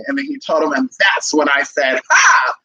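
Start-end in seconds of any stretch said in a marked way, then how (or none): 2.1-2.5 excited. Retold or imitated. Dramatic exclamation in story.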